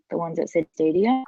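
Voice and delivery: normal talking voice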